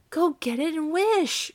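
high, loud, drawn-out frantic command; excited cry. A narrator's reading. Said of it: "quivering with excitement".

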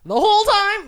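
high, loud incredulous quote; movie quote shouted high; Mrs Doubtfire voice. Acted.

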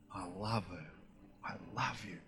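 very soft, low, intimate murmur; a loving father speaking very quietly to his child; tender fatherly love. Acted. Heavenly Father voiced tenderly to child.

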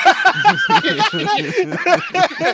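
high, loud burst of laughter; big laugh. Real, not acted. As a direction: crack up at your own joke. Laughing at own joke.

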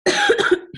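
sudden tickly cough mid-sentence; real cough. Real, not acted. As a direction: cough through a sore throat. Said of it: "excuse me for the coughing".

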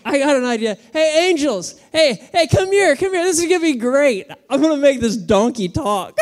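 God giddy with his plan, calling angels; excited God. Retold or imitated. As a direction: call the angels over like a kid with a prank. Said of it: "And he's like".

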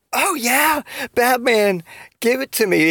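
high-pitched mock seductive woman; Catwoman. Catwoman to Batman in bed, mock seductive. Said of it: "it's Catwoman".

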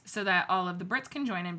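normal talking voice

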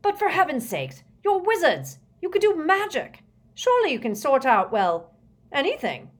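high, loud, desperate plea; exasperated outburst. A narrator's reading. Prime Minister begs the wizards to help, exasperated outburst. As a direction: burst out incredulously, falling off at the end. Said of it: "burst from him at last".

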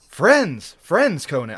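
high, loud, placating call; eager pirate appeal; eager cry. A narrator's reading. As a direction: eagerly insist you are friends. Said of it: "they cried eagerly".

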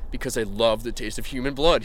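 ordinary delivery

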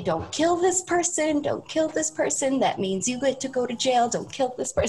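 strained high inner voice holding back rage; inner rage monster. Retold or imitated. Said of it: "the Italian rage monster inside me".

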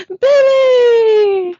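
long, high, loud mock scream; hammed-up scream. Passenger screams for the driver, hammed-up. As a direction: scream the name, drawn way out. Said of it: "screams, and she's like".

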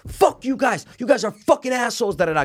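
high-pitched imitation of a screaming friend; Dan. Friend screaming insults at golfers, going nuts. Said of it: "Dan screaming".

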